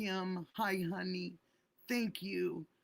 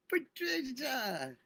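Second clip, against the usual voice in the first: high and louder, drawn out; loud call of a name; 7 semitones higher, 5 dB louder, slower than usual. Real, not acted. Streamer calling a beloved supporter's name, high and loud. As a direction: call the name out slowly with feeling.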